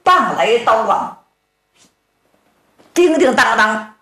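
ordinary delivery

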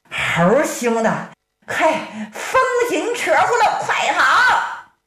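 高声急促地招呼兄弟逃; 拔高嗓门喊同伴快撤; 急喊快跑. Acted. 招呼同伴逃跑. (高声急喊快跑)

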